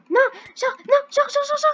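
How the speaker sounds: high, loud frantic yelling; panicked shout. Real, not acted. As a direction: scream it in desperate panic. Enemy found him, panic.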